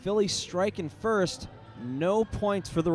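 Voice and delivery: excited derby announcer call; live play-by-play. Real, not acted.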